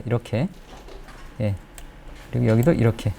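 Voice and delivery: ordinary delivery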